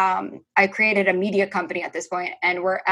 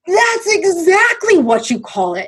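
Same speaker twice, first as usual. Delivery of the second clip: high, slow, emphatic exclamation; retold eureka; 9 semitones higher, 4 dB louder, slower than usual. Friend seizing on her joke podcast name, thrilled. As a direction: stretch it out like a big discovery.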